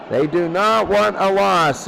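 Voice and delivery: ordinary talk, nothing marked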